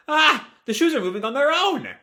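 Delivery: high-pitched startled exclamation; startled cry. Acted. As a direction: yelp as the shoes move by themselves. Startled character reacts to moving shoes.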